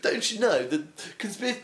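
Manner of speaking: high laughing imitation; laughing while mocking a party bore; mock conspiracy nut, laughing. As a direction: giggle through a smug question. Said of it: "they go like".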